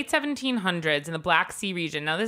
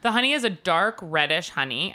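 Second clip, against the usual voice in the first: ordinary delivery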